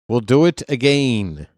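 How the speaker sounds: "again" with its last vowel stretched out; vowel emphasis. Shown as a demonstration. Correcting Rhino on the catchphrase, stretched vowels on "again". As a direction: lean long on the vowels of "again". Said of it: "put the emphasis on the vowels".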